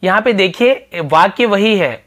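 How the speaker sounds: usual speaking voice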